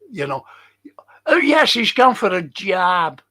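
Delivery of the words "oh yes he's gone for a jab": "jab" for job, louder and higher; imitating an actor's botched British accent; mock American actor. Mocking American actors who can't say job, hammed-up accent. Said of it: "They can't say job".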